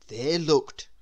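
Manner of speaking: ordinary delivery, nothing marked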